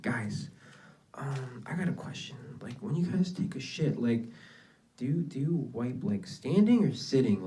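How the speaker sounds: whispered, embarrassed question. Retold or imitated. Asking classmates an embarrassing question, whispered. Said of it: "I'm like".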